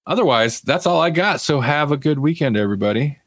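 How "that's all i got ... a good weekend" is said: normal talking voice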